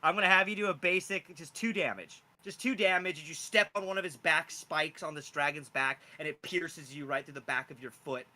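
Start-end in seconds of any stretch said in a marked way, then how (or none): none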